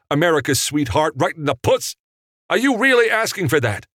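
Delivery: loud, high-pitched indignant tirade; acted outburst of a riled director; outraged disbelief. A narrator's reading. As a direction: protest in incredulous high pitch. Director refusing to shoot scene.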